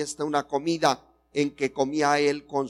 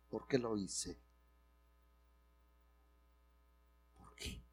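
quiet lament; 5 semitones lower, 17 dB quieter than usual. Retold or imitated. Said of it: "tienes que lamentar".

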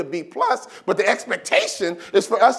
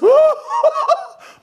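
squealing giddy shriek of a laugh; high shrieking laugh; 9 dB louder than usual. Acted. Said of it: "they act like this".

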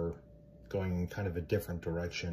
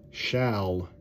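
shall drawn out and loud with a falling tune; stress on shall; 6 dB louder than usual. Shown as a demonstration. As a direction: lean hard on the word shall. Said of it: "I put a lot of emphasis in the word shall".

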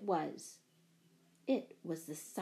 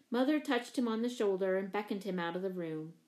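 plain reading voice

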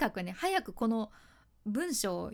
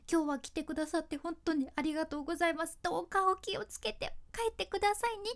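sincere; 6 semitones higher than usual. Shown as a demonstration. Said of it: "そんな気持ちが入った文章".

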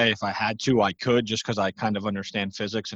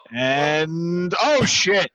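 surprised shout; 5 semitones higher, 3 dB louder, much slower than usual. Acted. Commentating a backflip fail.

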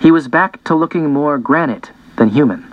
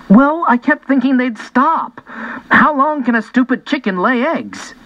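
put-on higher-pitched, exasperated boy; acted exasperation, pitched up; exasperated self-defense; 10 semitones higher than usual. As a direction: protest your case to your mother. Boy defends himself to his mom.

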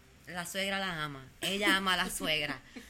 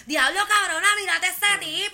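higher and louder, a hammed-up catcall; mock shout; 10 semitones higher, 10 dB louder than usual. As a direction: yell it out like gawking at a guy. (grito burlón, más agudo y fuerte)

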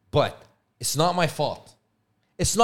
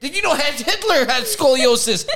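high, loud, eager voice sharing a random fact; mock know-it-all; 9 semitones higher, 6 dB louder than usual. Mocking a friend lost in a rabbit hole, eager. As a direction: announce it like a big discovery.

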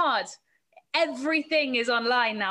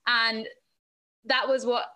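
usual speaking voice

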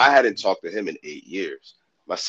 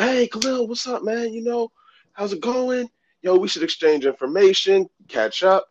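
much higher, hearty greeting voice; imitated old coach. Old coach greeting former player, imitated way up high. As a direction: mimic the coach in a high-pitched hello.

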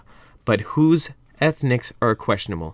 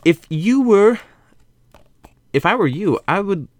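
put-on character voice; 7 semitones higher, 3 dB louder than usual. Voicing a character's advice.

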